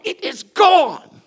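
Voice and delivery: put-on high, slow, drawn-out little girl's denial; imitating the daughter dragging out her stubborn denial; animated daughter. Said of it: "she's very animated".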